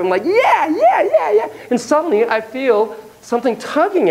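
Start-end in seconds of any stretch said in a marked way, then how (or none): 0.2-1.5 high, exuberant cheering; happy whooping. Retold or imitated. Surfer zipping along the wave, high happy whooping. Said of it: "I am so happy".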